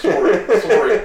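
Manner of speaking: high, loud Canadian "Sorry, sorry"; mock Canadian sorry. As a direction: play up the polite Canadian apology.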